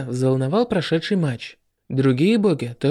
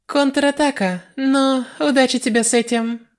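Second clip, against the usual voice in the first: put-on high, teasing young mage; mocking girl voice; 10 semitones higher than usual. A narrator's reading. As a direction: taunt the enemy with a high giggle in your voice. Reimi mocks her opponent.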